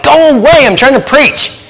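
high, exasperated mock shout; retold yell. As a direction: yell it to shoo someone off. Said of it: "I'll go out and I'll yell".

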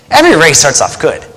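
ordinary delivery

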